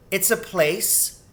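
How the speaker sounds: put-on high, slow child's voice; piping young rat, drawn out; girl rat. A narrator's reading.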